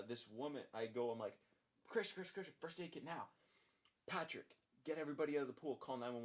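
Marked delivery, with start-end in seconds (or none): 1.9-6.2 urgent calling out. Retold or imitated. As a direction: call out orders in an emergency. Calling coworkers during emergency.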